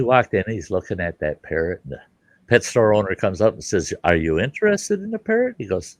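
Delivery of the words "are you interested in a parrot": lightly voiced shopkeeper, much higher pitch; sales pitch question. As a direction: ask it high like an eager seller. Pet store owner's sales question.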